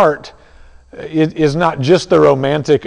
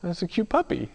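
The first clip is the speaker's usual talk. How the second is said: high, softer cooing over a cute puppy; mock cooing at a puppy; 6 semitones higher, 8 dB quieter than usual. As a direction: coo it like you're melting over a puppy. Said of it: "affection, like".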